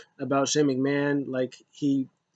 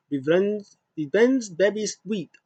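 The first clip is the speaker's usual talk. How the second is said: higher, sing-song mock Japanese accent for Asuka; 6 semitones higher, slower than usual. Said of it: "Hope that wasn't racial".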